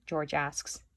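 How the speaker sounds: usual speaking voice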